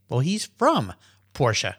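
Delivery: usual speaking voice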